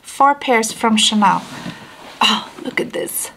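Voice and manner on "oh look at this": whisper. Real, not acted. Unboxing reveal, hushed.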